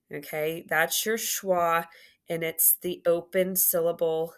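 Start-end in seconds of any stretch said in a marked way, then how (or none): none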